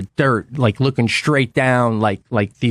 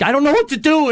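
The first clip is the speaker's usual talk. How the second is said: high, frantic voice, shaking; much higher, near panic; panicked; 12 semitones higher, faster than usual. Retold or imitated. Stuck on the icy hairpin, he panics high. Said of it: "I'm shaking and I'm like".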